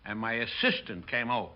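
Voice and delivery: ordinary talk, nothing marked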